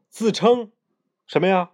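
ordinary delivery

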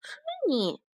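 high squeaky question with a giggle; amused little dinosaur. Acted. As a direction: tease the silly idea.

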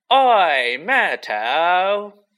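loud, high bellowed call; bellowing. A narrator's reading. Poltergeist summons the ghost girl across the party, bellowing. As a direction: holler it out at full breath. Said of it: "took a deep breath and bellowed".

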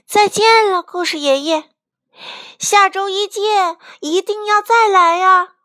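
high, loud chick calling goodbye; high-pitched farewell shout. A narrator's reading. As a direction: call it out eagerly after someone leaving. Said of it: "卡梅利多喊道".

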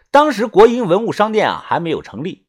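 plain reading voice

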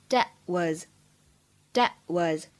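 "that was" with the final t in "that" held, unreleased; "that was", slowly, no burst on the t; incomplete plosion. Shown as a demonstration. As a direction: stop the t of "that" silently before "was". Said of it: "类似不完全爆破的处理".

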